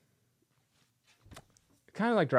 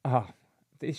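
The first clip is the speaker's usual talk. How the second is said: ordinary delivery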